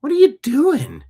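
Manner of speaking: dog asking its owner what's wrong, high and incredulous; dog's voice. Acted. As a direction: voice the dog pleading with the lady. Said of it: "This dog is going".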